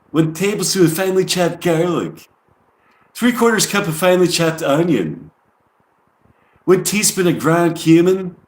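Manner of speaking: put-on a lightly done New York accent over a recipe list; mock New York accent. Reading [name]'s falafel recipe aloud, in a mock New York accent. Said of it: "tried to do it with a New York accent".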